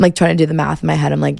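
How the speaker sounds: ordinary talk, nothing marked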